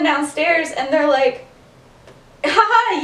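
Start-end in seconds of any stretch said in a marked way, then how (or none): 2.4-3.0 high, put-on ha ha; badly faked laugh to cover up; imitated fake laugh. Friends covering up to housemates about the deer, feigned laugh.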